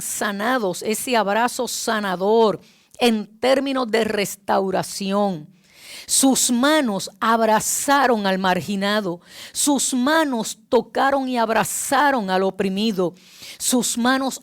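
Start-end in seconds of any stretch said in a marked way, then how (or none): none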